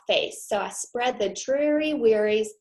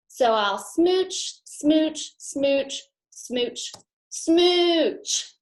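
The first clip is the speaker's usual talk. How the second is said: slow, higher kissy smooches; playful smooches; 7 semitones higher, 4 dB louder, much slower than usual. Acted. Fish happily blows kisses to friends, mock smooching. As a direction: smack each smooch playfully.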